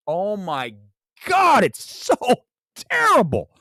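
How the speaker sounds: high, strained exasperation; exasperated outburst. Real, not acted. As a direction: wail it in sheer disbelief, word by word. Real rant about a hated film.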